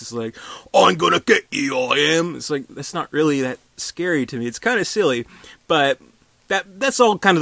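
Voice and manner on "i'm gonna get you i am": Cockney accent, louder and higher, hammed-up; mock Cockney ghost. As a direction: threaten in a silly Cockney accent. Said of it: "this Cockney British man's voice".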